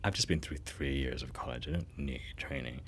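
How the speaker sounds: low, soft, creaky mock disdain; imitating a jaded graduate in vocal fry; attitude with vocal fry. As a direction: sulk low in your throat, creaking. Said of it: "attitude, like".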